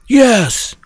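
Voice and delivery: high, put-on emphatic confirmation; insistent wife. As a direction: imitate an exasperated wife insisting.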